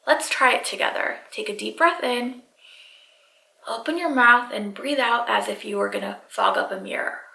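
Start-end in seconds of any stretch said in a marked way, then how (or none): none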